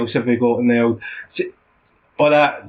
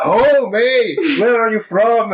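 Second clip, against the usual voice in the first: high, hammed-up Albanian voice; put-on Albanian accent; 9 semitones higher, 3 dB louder, slower than usual. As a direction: challenge him in a fake Albanian accent. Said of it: "I can't put this on".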